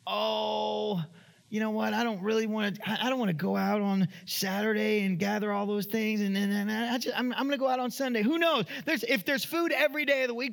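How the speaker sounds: scheming Israelite. Acted. An Israelite talking himself into gathering food on the Sabbath, sly and wheedling. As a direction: talk yourself into breaking the rule.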